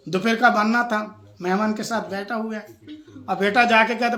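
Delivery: ordinary delivery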